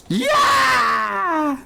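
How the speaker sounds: high, loud victory yell "Yeah"; triumphant shout. Real, not acted. Streamer celebrating a kill, loud high yell. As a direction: cheer the kill at full voice.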